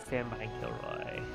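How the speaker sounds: ordinary delivery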